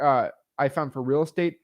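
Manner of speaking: ordinary talk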